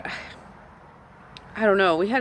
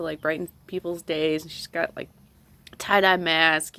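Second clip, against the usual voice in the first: normal talking voice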